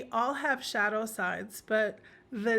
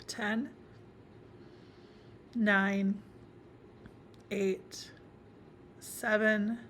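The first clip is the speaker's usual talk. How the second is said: guided meditation. Acted. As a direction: guide the listener inward, phrase by phrase, leaving space between.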